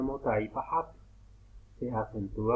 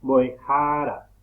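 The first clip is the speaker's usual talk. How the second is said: Boejara with the JA syllable punched; Boejara, stress on next-to-last syllable; 8 dB louder, slower than usual. Shown as a demonstration. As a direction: lean on the A of JA in Boejara. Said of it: "lleva acento la A porque no es final".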